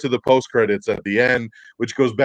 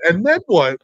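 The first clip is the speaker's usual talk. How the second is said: higher and louder, eager to hear more; goading; 8 semitones higher, 7 dB louder than usual. Retold or imitated. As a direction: push him on, high and loud. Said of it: "He did".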